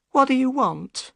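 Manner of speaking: high-pitched upset woman; Missus Smith, upset. A narrator's reading.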